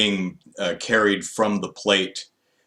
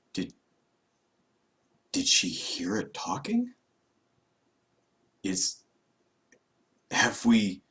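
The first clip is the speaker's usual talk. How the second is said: shocked whisper; 6 dB quieter than usual. Retold or imitated.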